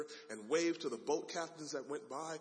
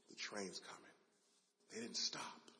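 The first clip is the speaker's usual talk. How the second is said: soft, low, stunned; quiet dread; 4 semitones lower, 9 dB quieter than usual. Acted. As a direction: say it to yourself in horror. Dad realizing disaster, quiet dread.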